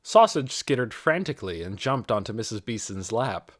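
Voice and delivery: ordinary delivery, nothing marked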